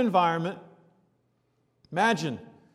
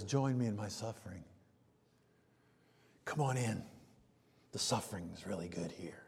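soft, softer, then a hushed whisper; a wry secret invitation, whispered; whispering "the suffering is really good here"; playful whisper; 9 semitones lower, 15 dB quieter than usual. Acted. Mock invitation in Paul's voice.

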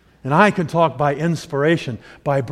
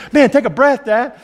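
amused plea; 8 semitones higher, 6 dB louder than usual. Retold or imitated. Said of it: "You think".

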